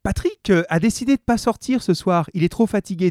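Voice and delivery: casual shop talk as the wife; lightly voiced housewife. A narrator's reading. Mary speaks to grocer.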